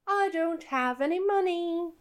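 sing-song. Acted. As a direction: chirp it innocently in a sing-song. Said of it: "in a sort of sing-song voice".

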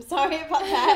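laughing while apologizing. Real, not acted. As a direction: giggle through the words. Laughing at own flatness.

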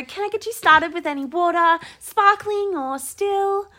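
fancy server voice. Retold or imitated. Offering water at fancy lounge, high server voice. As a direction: lilt it high and polite. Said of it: "I go".

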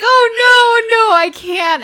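high, loud wail of dismay; hammed-up panicked refusal; mock panic. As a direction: cry out in exaggerated alarm. Retold panicked refusal to client.

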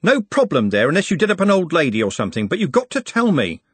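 high-pitched heated interrogation; exasperated. A narrator's reading. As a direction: grill him, thoroughly fed up. Red-faced Trev confronts Nut.

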